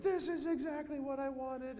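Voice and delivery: high squealing delight; excited kid. Retold or imitated. Priest voicing a kid opening gifts, high and thrilled. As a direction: squeal like a kid tearing open a gift. Child opening next gift.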